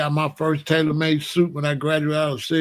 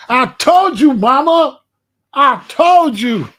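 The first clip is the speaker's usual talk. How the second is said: high, loud gloating, slower at the end; triumphant shout; 7 semitones higher, 10 dB louder than usual. Retold or imitated. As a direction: crow it in victory at mama. Triumphant son vindicated.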